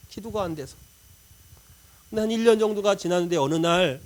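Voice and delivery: normal talking voice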